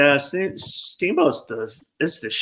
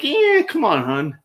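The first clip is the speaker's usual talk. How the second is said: high, sing-song sarcasm; high sarcastic aside to the rental guy; mock sarcastic; 8 semitones higher than usual. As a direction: roll your eyes and toss it off. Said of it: "I'm like".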